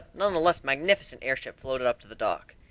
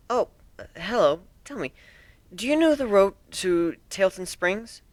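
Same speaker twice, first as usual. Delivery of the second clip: Twilight asking politely; 7 semitones higher than usual. Acted. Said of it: "Twilight asked".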